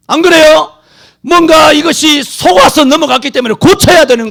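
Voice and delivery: loud, high preaching voice; booming sermon exhortation; fiery preaching. Acted. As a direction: rouse the congregation.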